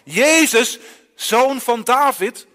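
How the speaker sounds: loud, drawn-out cry for help; desperate beggar shouting, stretched slowly; calling out loudly. Acted. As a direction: call across a crowd to Jesus. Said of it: "zo hard als hij kan".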